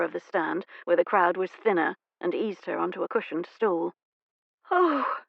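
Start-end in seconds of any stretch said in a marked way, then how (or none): 4.7-5.2 high, startled little cry; a sudden fluttery gasp; a faint lady coming round; exclaiming. Acted. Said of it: "she exclaimed".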